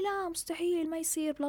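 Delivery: high, sing-song mock protest; mocking imitation of doubters. Mocking people who disagreed. (سخرية من المعترضين بصوت أعلى)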